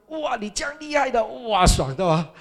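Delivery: high, loud gushing wow; mock amazed praise. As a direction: gush over him like an amazed friend. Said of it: "弟兄问我". (高声夸张地惊叹赞美)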